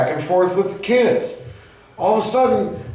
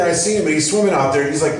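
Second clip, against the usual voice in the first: ordinary talk, nothing marked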